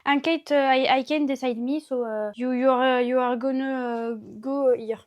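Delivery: put-on halting English with a heavy French accent; mock English. Girl sorting clothes jokes to viewers in broken French-accented English. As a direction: struggles through broken English in a French accent, slowing on you are gonna. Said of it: "l'accent anglais du futur".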